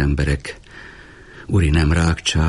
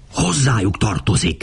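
hissed; 5 semitones higher than usual. A narrator's reading. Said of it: "sziszegte Démétriosz".

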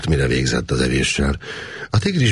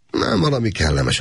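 ordinary delivery, nothing marked

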